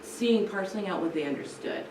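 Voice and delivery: usual speaking voice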